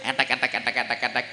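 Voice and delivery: mock machine rattle; sewing machine noise. Shown as a demonstration.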